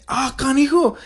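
high-pitched mock reaction; amused Spanish exclamation. Contrasting our reaction with classmate's, high exclamation. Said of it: "Like, we say".

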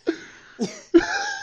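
loud burst of laughter. Real, not acted. Big laugh at joke.

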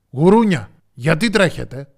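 loud lion's demand; forceful storybook interrogation; commanding question. A narrator's reading. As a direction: question them like a stern king. Lion interrogates the animals.

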